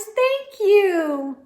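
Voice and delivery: high, loud squeaky flower voices; squeaky little flower voice; squeaking flowers. A narrator's reading. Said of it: "said the flowers".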